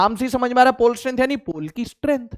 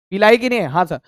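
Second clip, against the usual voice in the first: ordinary talk, nothing marked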